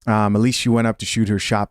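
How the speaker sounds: usual speaking voice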